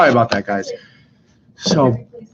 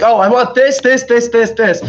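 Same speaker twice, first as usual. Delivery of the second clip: high, mock-whiny kid begging for more; whiny teenager; 7 semitones higher than usual. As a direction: mimic a spoiled kid pointing at everything. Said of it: "be like".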